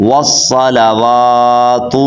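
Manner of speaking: slow tajweed model of والصلوات; correct long waw. Shown as a demonstration. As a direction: chant والصلوات slowly as "was-salawaatu", holding "waa". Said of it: "کیا پڑھیں گے؟".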